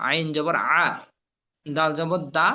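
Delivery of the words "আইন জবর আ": throat-pressed Arabic ain on "আ". Shown as a demonstration. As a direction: squeeze "আ" out of the throat. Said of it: "আইন চাপ". (গলায় চাপ দিয়ে "আ")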